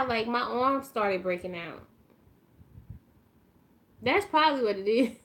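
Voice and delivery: ordinary talk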